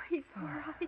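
breathy whisper of relief; relieved whisper. Acted. Relieved after rescue.

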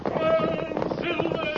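high calling shout. Acted. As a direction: call the horse on. Charge on horseback.

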